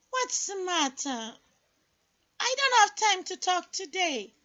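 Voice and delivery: sister voice. Acted. Sister character voice.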